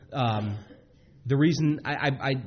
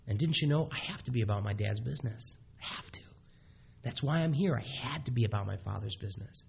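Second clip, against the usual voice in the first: gentle soft plea; 2 semitones lower, 6 dB quieter than usual. Retold or imitated. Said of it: "Jesus is looking here".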